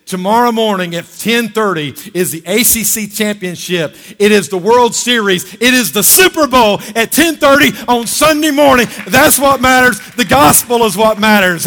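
loud, high, driving sermon shout; rousing pulpit shout; fired-up preaching. Acted. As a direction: bring the house down with it. Preacher's fiery quoted exhortation.

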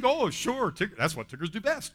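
high, loud, gung-ho cartoon reply; Tigger. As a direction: jump in eagerly, pitched right up. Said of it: "Tigger's always a, "Why not?".

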